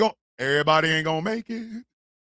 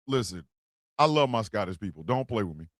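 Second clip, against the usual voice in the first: much lower and softer, half to himself; low aside; 11 semitones lower, 6 dB quieter than usual. Real, not acted. Telling Scottish viewers not to play, low and soft. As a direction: drop the voice right down, mock warning.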